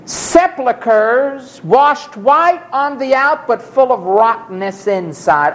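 high, slow, thundering denunciation; stern rebuke. Acted. Preacher voicing Christ rebuking the hypocrites, slow and high. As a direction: condemn them from the pulpit.

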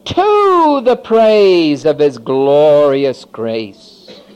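drawn out slow and loud; slow, loud scripture emphasis; slow emphatic reading. Acted. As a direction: lay each word down heavily. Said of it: "Underline that word freely".

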